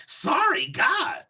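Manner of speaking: high-pitched, exasperated mock apology; snotty apology. Imitating the cart woman brushing it off, snotty. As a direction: toss it off high and put out. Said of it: "She was just like".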